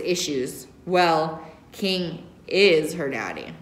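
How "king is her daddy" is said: ordinary delivery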